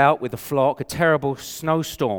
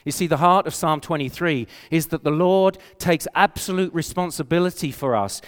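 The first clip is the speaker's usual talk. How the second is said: ordinary talk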